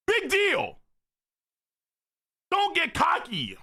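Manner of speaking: high-pitched acted rebuke; high voice dropping at each phrase end; scolding character voice. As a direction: scold sharply in a high anime voice. Acts out manga dialogue.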